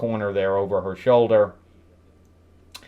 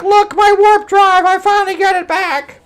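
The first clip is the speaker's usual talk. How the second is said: mock triumph; 7 dB louder than usual. Voicing the gorilla regaining his warp drive, mock triumph. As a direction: crow it out at the top of your range.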